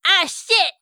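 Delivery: high, loud curse; panicked little sidekick swearing; high loud minion yelp; Steve's voice. Acted. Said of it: "Steve going".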